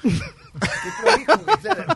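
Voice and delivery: high squeaky shocked question; surprised friend. Retold or imitated. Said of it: "Mariano López le probaba y dice". (sorpresa burlona, muy aguda)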